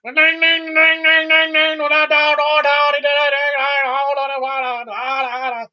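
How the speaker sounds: high, loud rapid-fire scolding imitation; mock scolding chatter. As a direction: hammer the syllables like a nagging wife. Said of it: "when she was going".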